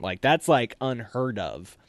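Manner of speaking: ordinary talk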